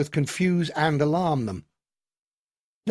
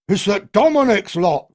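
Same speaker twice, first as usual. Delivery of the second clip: loud, higher angry cry; cried out accusation; 6 semitones higher, 8 dB louder than usual. A narrator's reading. Another villager cries out an accusation, loud. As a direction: blame them outright. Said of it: "cried another".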